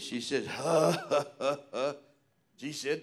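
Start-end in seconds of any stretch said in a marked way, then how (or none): 0.5-1.9 mock laugh of an old church lady; imitated laugh. Preacher imitating a lady laughing at him, mock laugh. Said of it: "laughed like this".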